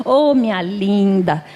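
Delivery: slow, drawn-out sweet talk; coaxing husband. Retold or imitated. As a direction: imitate a husband soothing his cross wife. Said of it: "quando eu tô muito brava, ele fala assim".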